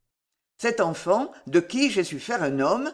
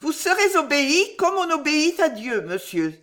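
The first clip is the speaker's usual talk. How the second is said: high-voiced submissive young woman; poor cowed girl; 9 semitones higher than usual. A narrator's reading. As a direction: pledge obedience in a high voice, falling on the last word. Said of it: "la pauvre fille dit, sans oser regarder". (voix aiguë de jeune fille soumise)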